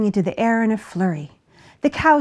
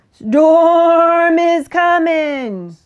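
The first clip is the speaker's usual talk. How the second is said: high, loud, long-drawn bleat; very slow, panicky sheep cry; 7 semitones higher, 8 dB louder, much slower than usual. A narrator's reading. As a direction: bleat the alarm like a sheep, stretching every word. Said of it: "The sheep bleated".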